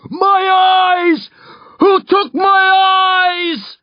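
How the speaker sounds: high, loud, anguished screams; shrieking from inside a nightmare; screaming. A narrator's reading. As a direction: scream it in blind panic. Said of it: "A man screams".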